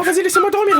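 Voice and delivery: normal talking voice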